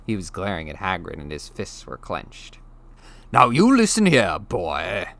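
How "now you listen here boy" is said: loud, menacing bully; snarled threat. Acted. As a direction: growl it at the boy, then drop on boy. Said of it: "he snarled".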